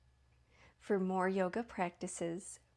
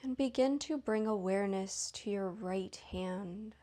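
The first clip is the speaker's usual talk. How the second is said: unhurried, even meditation voice, lingering between phrases; guided meditation. Real, not acted. Leads guided yoga nidra.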